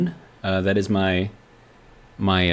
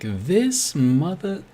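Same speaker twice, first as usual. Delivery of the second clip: lightly imitated, drawn-out high grumble; slow, rising mock exasperation, cut short; Charles; 8 semitones higher, much slower than usual. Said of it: "Charles is probably over there like".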